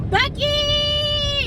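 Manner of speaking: high, drawn-out excited call. Real, not acted. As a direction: sing out the name with glee. Calling out on arrival.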